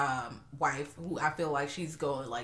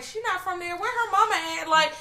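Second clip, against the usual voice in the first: exasperated outburst; 14 semitones higher, 5 dB louder than usual. Retold or imitated. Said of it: "'cause I'm like".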